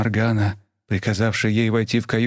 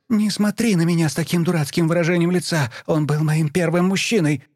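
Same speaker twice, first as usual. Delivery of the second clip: higher, angry yelling; furious daughter; 7 semitones higher than usual. A narrator's reading. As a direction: throw it in her face. Daughter screams at her mother.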